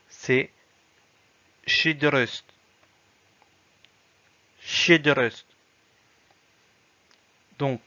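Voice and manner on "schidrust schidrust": schidrust said loud and deliberate; model word. Shown as a demonstration. Teacher models the hard word schidrust. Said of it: "cette lettre englobe tout ça".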